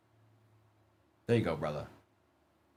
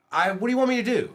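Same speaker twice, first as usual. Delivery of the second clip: higher and quick, mock helpless; exasperated protest; 9 semitones higher, much faster than usual. Real, not acted. Host to complaining chat, fast exasperated protest. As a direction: throw up your hands at the viewers.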